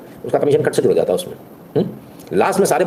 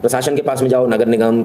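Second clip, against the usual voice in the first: ordinary talk, nothing marked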